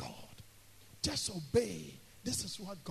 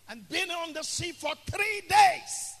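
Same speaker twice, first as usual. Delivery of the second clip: loud, high-pitched sermon storytelling; full-force preaching; 10 semitones higher, 11 dB louder than usual. Real, not acted. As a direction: cry out the lost hope from the pulpit.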